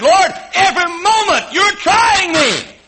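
high, loud, slow shouted lament; Job's complaint. Acted. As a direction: cry it out at God. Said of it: "But he said".

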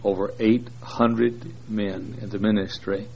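usual speaking voice

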